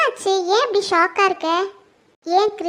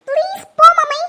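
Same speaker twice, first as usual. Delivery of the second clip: loud, high-pitched begging; upset plea; 6 semitones higher, 9 dB louder than usual. Acted. Daughter insisting her mother go away, loud upset plea. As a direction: push the plea out, raised and insistent.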